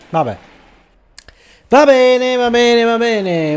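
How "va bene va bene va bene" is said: high, loud, slow sarcastic "va bene"; exasperated. Real, not acted. Gamer annoyed at his pitcher, loud exasperated drawl. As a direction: throw up your hands, drag it out. Frustrated after bad pitch.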